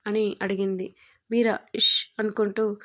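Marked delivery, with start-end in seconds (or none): none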